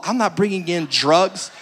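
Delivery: ordinary talk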